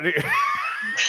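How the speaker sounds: sudden high burst of laughter. Real, not acted. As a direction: crack up and lose it. Breaks character laughing.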